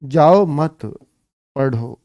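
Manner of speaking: group जाओ with मत, then drop on पढ़ो; pause after मत. Shown as a demonstration. Teacher showing how father says it, pause after मत. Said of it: "पिताजी ऐसे कह रहे हैं".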